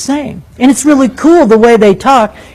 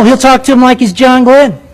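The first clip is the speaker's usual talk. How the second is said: ordinary talk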